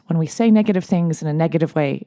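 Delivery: ordinary talk, nothing marked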